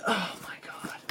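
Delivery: breathless whispered swoon; hammed-up whispered awe; awed whisper. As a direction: whisper it as if overwhelmed. Mock-desperate awe over a figure.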